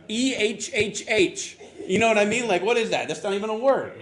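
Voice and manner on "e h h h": slow, stretched frustrated eh; drawn-out eh grunt. Shown as a demonstration. Imitating a frustrated Samoan, slow stretched eh. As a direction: drag the grunt out slowly. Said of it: "There's not even a word".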